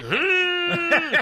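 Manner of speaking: loud, high comic whine; pained whimper. Retold or imitated. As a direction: imitate a hurt wife's squeal. Imitates wife's reaction to the slap.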